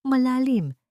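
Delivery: ordinary delivery, nothing marked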